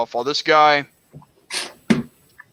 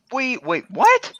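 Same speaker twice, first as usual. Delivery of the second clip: surprised; 10 semitones higher, slower than usual. Real, not acted. Disbelief at being asked for award.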